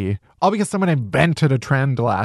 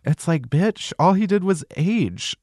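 ordinary talk, nothing marked

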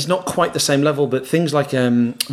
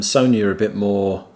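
usual speaking voice

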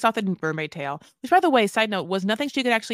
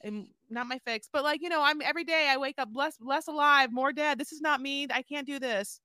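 playing Holly, despairing; 4 semitones higher than usual. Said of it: "you be her".

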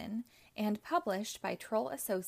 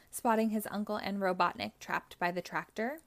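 ordinary delivery

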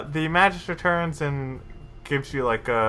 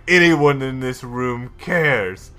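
louder stern rebuke; stern Justicar; 6 dB louder than usual. Acted. The Justicar scolding the Magister and Fendel, louder. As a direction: lay down the law to the room. Justicar slams fist on table.

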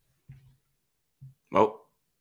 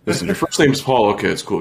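normal talking voice

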